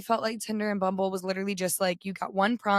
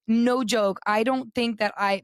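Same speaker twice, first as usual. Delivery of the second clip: ordinary talk, nothing marked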